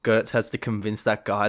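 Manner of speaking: ordinary delivery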